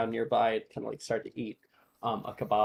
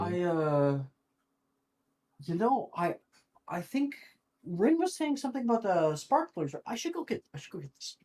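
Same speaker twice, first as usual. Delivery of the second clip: high, halting, flustered stammer; flustered excuse; 5 semitones higher than usual. Acted. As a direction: fumble for an excuse, pitch jumping up then dropping away. Levi flustered, making excuse to leave.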